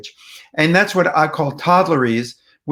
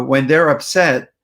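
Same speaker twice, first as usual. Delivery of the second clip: normal talking voice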